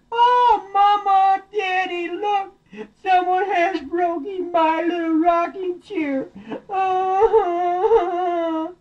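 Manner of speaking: very high, mock baby sobbing; hammed-up heartbroken bawling, long wails; wailing Baby Bear. Baby Bear cries over broken chair.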